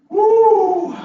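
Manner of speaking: a loud, high whoop; winded whoop. Retold or imitated. Man facing a whole new patch of weeds, a loud high whoop. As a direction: let out a big high woo.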